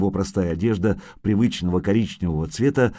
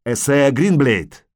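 slow, louder call; 4 semitones higher, 5 dB louder, slower than usual. Acted. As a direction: shout it slowly to the forest. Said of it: "крикнул он снова". (громкий медленный оклик)

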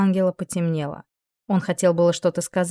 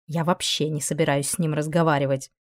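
usual speaking voice

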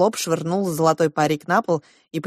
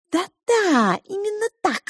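high-pitched squeaky mouse agreement; pink mouse; slower than usual. A narrator's reading. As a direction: pipe it up high like a little mouse. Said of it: "согласилась розовая мышь".

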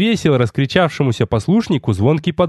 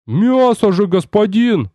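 high-pitched incredulous protest; baffled, raised-pitch exclamation; amazed protest; 6 semitones higher, slower than usual. A narrator's reading. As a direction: protest in astonishment to the master. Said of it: "поразился моему птицелюбию тёзка".

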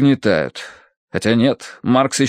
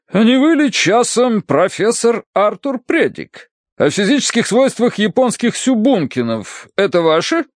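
blustery colonel, delighted; 12 semitones higher than usual. Acted. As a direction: draw out the question with delighted recognition. Colonel's figure in narrated story. (радостный полковник, нараспев)

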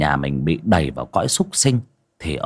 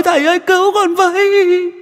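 far higher, wailing scream of a beaten spirit; shrieking ghost; 5 dB louder than usual. Acted. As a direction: howl under the blows. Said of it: "mà rú lên".

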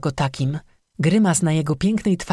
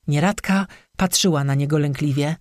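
ordinary delivery, nothing marked

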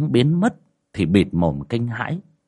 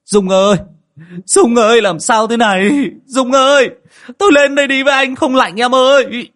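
high, loud frantic calls to his wife; panicked husband yelling for his possessed wife; desperate shouting; 8 dB louder than usual. Acted. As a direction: cry out to her across the pond. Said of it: "Anh Mẹo gào lên".

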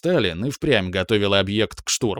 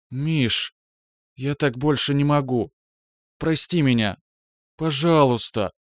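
higher, pleading and tearful; pleading apology; 3 semitones higher than usual. Acted. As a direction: beg forgiveness with a catch in the voice. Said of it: "Упадающий голос Браиловой позванивал".

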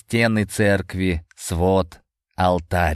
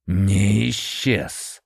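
cold correction; much slower than usual. A narrator's reading. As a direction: correct him coldly. Master corrects.

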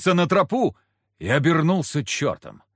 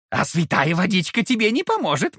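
sly devil voice, rising on не; mock the boy as a sneering demon; gloating devil; 5 semitones higher than usual. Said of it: "снова забормотал Фока".

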